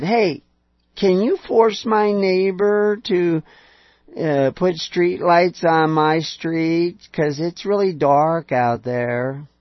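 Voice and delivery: drawn-out plea to the state, half mocking; mock pleading request. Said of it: "and said".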